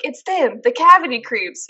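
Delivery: mock heroic kids raising the alarm; commercial kids' alarm. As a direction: call out like kids in a cheesy adventure ad. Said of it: "these kids came out with their big toothbrushes".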